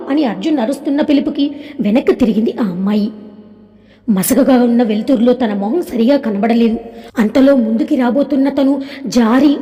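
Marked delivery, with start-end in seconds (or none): none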